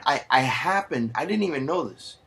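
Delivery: ordinary talk, nothing marked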